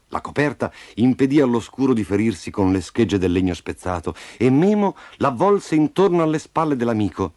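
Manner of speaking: ordinary delivery, nothing marked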